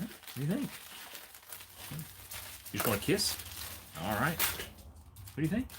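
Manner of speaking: soft, high, slow cooing; tender pet talk. Acted. Owner asking his dog about the toy, soft high coo.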